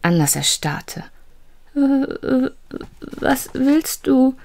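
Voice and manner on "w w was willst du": high, stammering, slow and frightened; a terrified boy stammering into the dark; trembling voice. Acted. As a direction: shake and stutter with fear. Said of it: "mit zitternder Stimme".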